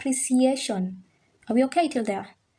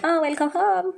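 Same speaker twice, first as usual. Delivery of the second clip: delighted; 8 semitones higher than usual. Pretend. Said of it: "you're like".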